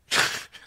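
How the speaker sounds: short breathy embarrassed laugh. Real, not acted. Pastor laughing at his own Uncle Sam photo, short laugh.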